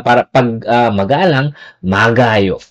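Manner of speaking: ordinary talk, nothing marked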